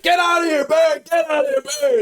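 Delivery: high, loud shouting to scare off a bear; retold yell. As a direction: yell at the bear to drive it away. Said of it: "Alex is standing there yelling".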